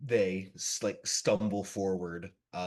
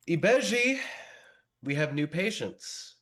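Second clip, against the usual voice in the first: much higher and louder call down the stairs; 9 semitones higher, 4 dB louder than usual. Acted. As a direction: summon the servant from afar. Calls servant from stairs.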